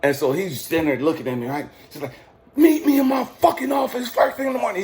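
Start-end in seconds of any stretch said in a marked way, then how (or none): 2.5-4.8 high, hollered order in imitation; mock commander's yell. Commander orders recruit to his office, mock yelling. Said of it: "he's just yelling".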